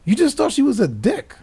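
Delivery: high, loud, sassy mock challenge; mocking sass. Friend retelling calling out a fake-nice stranger, high sassy mock. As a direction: call them out in a high teasing voice.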